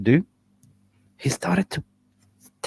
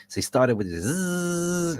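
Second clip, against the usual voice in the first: machine buzz; 8 semitones higher, slower than usual. Retold or imitated. Imitating the cleaning machine.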